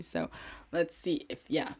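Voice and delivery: usual speaking voice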